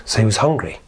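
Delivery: plain reading voice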